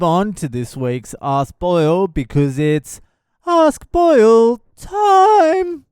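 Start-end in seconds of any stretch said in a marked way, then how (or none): 3.5-5.8 high, loud, slow sung-out segment call; announcer jingle. Acted. Host announcing his segment as a jingle. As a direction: belt out the segment name like a jingle.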